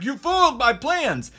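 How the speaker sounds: high-pitched hammed-up villain line; mock villain. Reviewer mimicking the film's landlady, mock villain.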